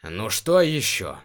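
slow, irritated grumble; slightly cross at the interruption; mildly annoyed. Acted. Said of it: "слегка рассердился Гессар".

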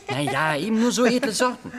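high laugh sliding into eager talk; nervous laugh. Acted. Boy laughs off the bully's doubt, eagerly. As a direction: laugh it off and keep bragging. Boy covering his made-up story.

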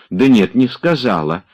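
impatient. A narrator's reading. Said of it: "с нетерпением говорил он".

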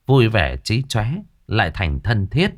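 ordinary delivery

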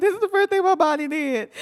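high, loud mock wail breaking into laughter; imitated crying voice. Caller explaining her tears to friend, hammed-up wail. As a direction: sob the words in exaggerated fashion. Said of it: "Why are you crying?".

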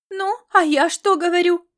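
high, loud triumphant exclamation; mother claiming victory over her daughter, loud; exclaiming. Acted. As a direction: crow that she was right. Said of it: "воскликнула Лилиан".

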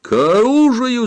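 very high, loud battle cry; shouted call to arms. A narrator's reading. Fighter charges forward calling to arms, shouting. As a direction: throw the order out high and loud. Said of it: "закричал Самбельонг".

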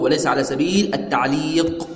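ordinary talk

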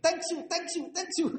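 high squeaky thank-yous with a laugh; squeaky rat voice. Retold or imitated. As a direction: play the grateful fleeing rat, high and giggly. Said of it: "diterjemahkan bahasa Inggris gimana".

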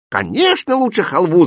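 high, emphatic halva seller; high-pitched hawker's cry; vendor's shout. A narrator's reading. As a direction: jump in eagerly to win the sale. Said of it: "закричал продавец халвы".

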